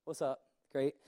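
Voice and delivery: low, soft and unimpressed, mock shrug; a lightly voiced family that can't be bothered; bored kids. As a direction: play the kids who barely look up when Dad walks in. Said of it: "they be like".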